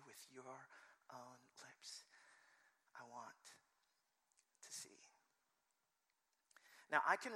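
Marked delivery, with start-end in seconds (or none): 0.0-5.1 very soft, nearly whispered, with pauses; gentle, hushed invitation; tender hush. Acted. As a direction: drop to a hush and let each phrase land. Voicing Jesus tenderly to Bartimaeus.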